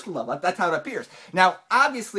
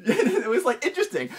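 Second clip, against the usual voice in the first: laughing; 6 semitones higher than usual. Real, not acted. Laughing at the memory.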